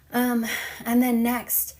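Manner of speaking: breathless, puffing through the words; out of breath. Real, not acted. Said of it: "I'm getting out of breath".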